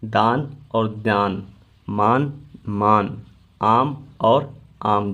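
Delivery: दान said slowly, then again with a nasal vowel; careful nasalization demo. Shown as a demonstration. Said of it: "लिखित रूप और उच्चारण क्या है इसका देखते हैं".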